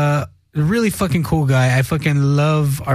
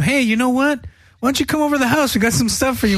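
higher-pitched, playful come-on; mock friendly invitation; 7 semitones higher, faster than usual. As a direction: pretend to lure him over cheerfully. Pretend friendly invitation to the thief.

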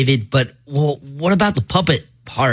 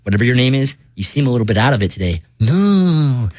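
ordinary talk

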